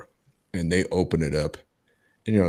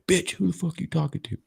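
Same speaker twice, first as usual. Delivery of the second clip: imitated angry demand; 11 semitones higher than usual. Frat guy catching captive on the phone, imitated angry challenge. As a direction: mimic an aggressive guy snapping at someone.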